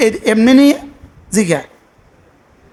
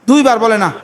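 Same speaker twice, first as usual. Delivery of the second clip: ordinary talk, nothing marked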